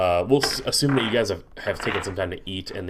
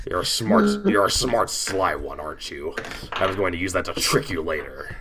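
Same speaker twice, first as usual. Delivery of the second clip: low, taunting warlock voice; villainous shapeshifter, caught out; sly warlock; 3 semitones lower than usual. Acted. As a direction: sneer knowingly as an unmasked warlock.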